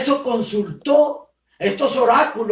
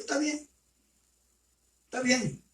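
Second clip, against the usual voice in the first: very soft, higher-pitched giving-in; meek assent; 3 semitones higher, 10 dB quieter than usual. Retold or imitated. Threatened prophet agreeing to obey, meek assent. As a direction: murmur a subdued agreement, as if threatened.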